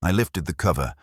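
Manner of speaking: usual speaking voice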